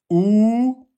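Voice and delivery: "Ou" stretched long and slow; slow drawn-out ou. Shown as a demonstration. Teacher recapping how to form waw. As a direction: draw the "Ou" out slowly. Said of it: "on sort les lèvres".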